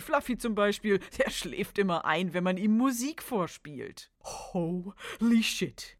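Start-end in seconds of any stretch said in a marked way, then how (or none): 4.5-5.9 very slow, stunned curse. Acted. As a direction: drag it out in shock. Kids react to Fluffy secret.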